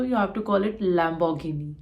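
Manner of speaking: ordinary talk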